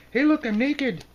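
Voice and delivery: very high, loud squeaky announcing; silly card figure. Acted. Naked card figure announcing himself, very high and gleeful.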